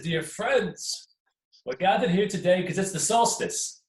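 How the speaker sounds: a badger opening the gathering grandly. A narrator's reading.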